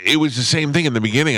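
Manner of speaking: ordinary delivery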